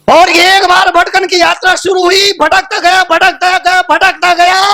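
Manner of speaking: high, ringing declamation; sermon cry, pitched right up; impassioned preaching. Real, not acted. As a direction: proclaim it to the congregation on a high held pitch.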